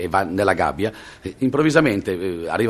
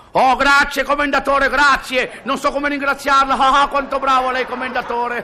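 fawning employee; 13 semitones higher than usual. Acted. Employee grovels to boss.

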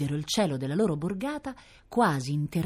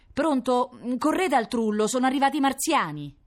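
urgent phone call; 10 semitones higher, 4 dB louder than usual. Acted. Panicked call to firemen.

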